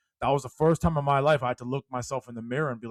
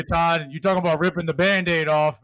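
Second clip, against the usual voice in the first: ordinary talk, nothing marked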